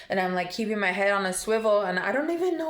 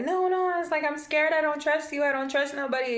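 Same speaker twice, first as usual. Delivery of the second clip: higher, pleading, frightened retold lines; scared refusal; 8 semitones higher, faster than usual. Retelling telling the man she trusts nobody, high and scared. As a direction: back away from him with your voice pitched up high.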